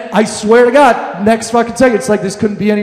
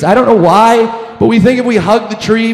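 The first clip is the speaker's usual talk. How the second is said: ordinary talk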